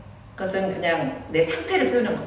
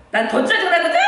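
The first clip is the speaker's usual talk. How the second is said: much higher, louder laughing retort; mother snapping back; 11 semitones higher, 6 dB louder than usual. Retold or imitated. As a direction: throw it back at the kid, half joking. (아이에게 되받아치는 엄마, 웃음 섞인 짜증)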